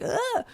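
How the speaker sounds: short high-pitched gasp of alarm; alarmed yelp. Retold or imitated. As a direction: imitate a parent's sudden high cry at danger. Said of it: "safety always makes me raise my voice".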